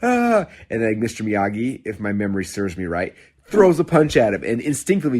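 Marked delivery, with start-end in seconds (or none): 0.0-0.5 high, loud frustrated yell at the teacher; student yelling at his teacher that he came to learn karate. Retold or imitated. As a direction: shout it up high like a fed-up kid. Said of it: "he starts yelling".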